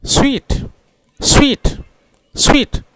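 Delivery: स्वीट as one high syllable, "sweet"; high-pitched, repeated one-syllable स्वीट; correct pronunciation. Shown as a demonstration. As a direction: model स्वीट high and crisp with a w glide. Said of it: "उसका pronunciation है".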